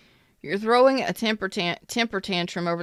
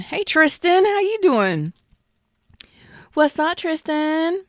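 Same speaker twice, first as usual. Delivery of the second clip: high, louder bright hello; excited greeting; 10 semitones higher, 6 dB louder, slower than usual. Real, not acted. Reader greets Tristan in chat, high and excited. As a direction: sing out a big hello to a viewer.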